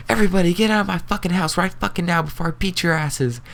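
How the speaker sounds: high-pitched furious order to get out; enraged mom yelling. Retold or imitated. Mom ordering the kids out of her house, screaming in rage. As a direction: shriek it like a mother throwing everyone out.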